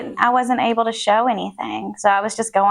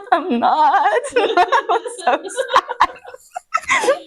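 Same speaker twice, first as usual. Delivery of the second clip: high-pitched tearful wail through laughter; mock crying; 13 semitones higher, slower than usual. Retelling the drive home after failing, mock sobbing. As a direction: imitate crying, laughing as it comes out. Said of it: "I cried literally all".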